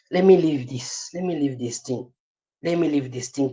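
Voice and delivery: soft and low, half under the breath; muttered to self. Real, not acted. Teacher quietly mutters to himself, moving on from the topic. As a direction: mumble it as you turn to your notes.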